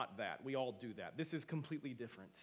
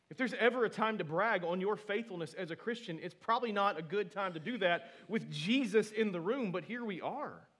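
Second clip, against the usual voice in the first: loud, high preaching burst; 5 semitones higher, 10 dB louder than usual. Real, not acted. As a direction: drive the point home full force. Preacher's emphatic build-up.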